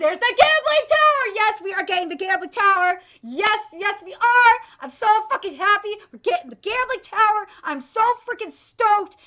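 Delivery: loud, high, thrilled shouting of good news; overjoyed cheering. Real, not acted. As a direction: cheer like your wish just came true. Gambling tower confirmed, overjoyed.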